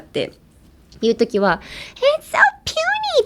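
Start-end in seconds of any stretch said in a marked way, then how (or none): 2.2-3.2 very high, doting squeal; adoring squeal. Shown as a demonstration. Doting on something small, high squeal. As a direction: pour all the affection into it. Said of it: "愛おしさ全振りで使う".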